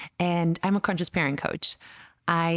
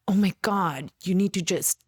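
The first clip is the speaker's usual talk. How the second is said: mock exasperated outburst, rising higher on the last words; retold exasperation; 4 semitones higher than usual. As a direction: act out a parent at breaking point.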